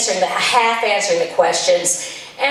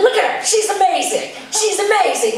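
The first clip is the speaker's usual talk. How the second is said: proud gush; 9 semitones higher than usual. Real, not acted. Proud of daughter.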